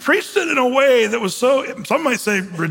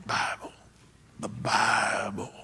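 low, slow muttered words into the mic; a hint of a preacher mumbling into the microphone; pastor muttering; 10 semitones lower, 10 dB quieter, slower than usual. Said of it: "you could hear him kind of muttering".

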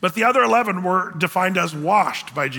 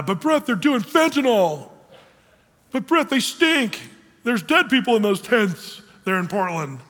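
higher, lightly mocked objection; mock a congregant's protest, pitched up; 5 semitones higher than usual. Congregant objecting to helping the homeless, mock protest.

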